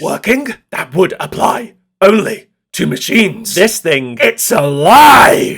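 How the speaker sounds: high, slow, booming mad-scientist villain; over-the-top villain voice. Acted. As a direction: ham it up like a mad villain, building to a shout. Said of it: "in a very over-the-top villain voice".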